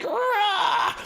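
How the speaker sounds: high roaring shriek; guttural inhuman rah; inhuman scream. Acted. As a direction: let out a monstrous cry. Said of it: "you hear this scream".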